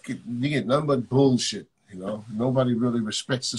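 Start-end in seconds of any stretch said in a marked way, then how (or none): none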